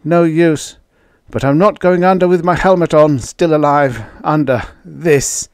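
loud, high voice of a trapped man; desperate refusal. A narrator's reading. Trapped man's desperate last words.

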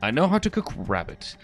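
low, lightly voiced thug; wizard thug. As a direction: mutter it like a gruff thug. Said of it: "wizard thug".

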